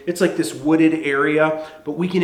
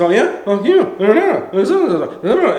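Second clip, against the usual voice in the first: loud, high squabbling gibberish; hammed-up back-and-forth nagging; mock bickering; 6 semitones higher, 6 dB louder than usual. Girlfriend retorting to her boyfriend, mock bickering. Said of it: "kind of bickering back and forth".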